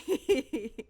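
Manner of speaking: short strained laugh; a trapped man's chuckle; nervous laugh. A narrator's reading. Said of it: "a low laugh".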